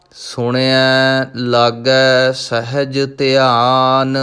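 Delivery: slow, measured chanted recitation of gurbani; scripture recitation. Acted. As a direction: recite each line slowly and devoutly. (ਹੌਲੀ ਹੌਲੀ ਗੁਰਬਾਣੀ ਦਾ ਪਾਠ)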